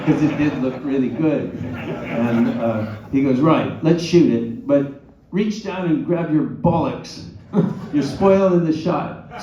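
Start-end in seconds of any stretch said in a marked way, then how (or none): 3.4-9.4 slow, loud, grudging British director; angry British accent. Retold or imitated. Annoyed director giving in to the actor, slow loud British accent. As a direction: order it gruffly in a mock British accent. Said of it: "Alan was really angry now".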